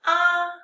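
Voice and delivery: "Ah" held high and level; first tone. Shown as a demonstration. Teacher leading read-after-me tone drill, high level "ah". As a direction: sustain "ah" on one high pitch. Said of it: "the tones".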